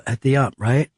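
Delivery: normal talking voice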